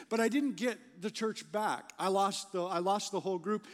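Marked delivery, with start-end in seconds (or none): none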